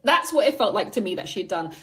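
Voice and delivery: ordinary talk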